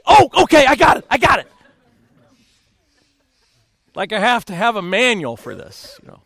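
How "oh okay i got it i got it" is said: loud, high, hurried acting-out; mock panic, jump to it; flustered compliance. Husband obeying his sobbing wife, mock flustered and loud. Husband startled by crying wife's demand.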